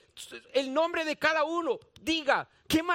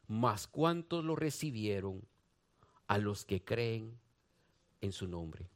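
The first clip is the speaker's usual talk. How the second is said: dropping much lower and softer, word by word; reverent hush; 8 dB quieter than usual. Acted. Preacher reading John to the congregation, sinking into a reverent hush. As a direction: let the promise sink into a near hush. Verse read softly.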